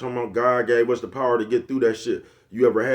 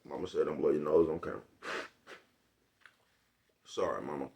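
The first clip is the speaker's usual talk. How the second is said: quiet aside; 8 semitones lower, 9 dB quieter than usual. Real, not acted. Aside about blowing nose.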